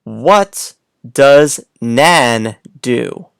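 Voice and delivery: put-on slow, word by word read to young kids; slow reading aloud. Shown as a demonstration. Teacher reading a sentence slowly to little kids. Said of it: "let's go to our next page".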